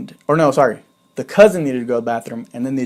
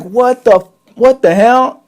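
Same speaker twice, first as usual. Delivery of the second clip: much higher and louder, rising in outrage; shocked outburst; 9 semitones higher, 9 dB louder, much slower than usual. Retold or imitated. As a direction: yell it, baffled and annoyed. Waking to find girls beside him.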